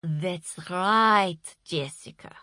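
patient guru voice. A narrator's reading. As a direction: explain as if to a small child. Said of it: "said Marcia patiently".

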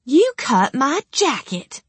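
slow, high, outraged cry; angry outburst. Acted. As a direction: yell it, scandalised. Jacket cut by captor.

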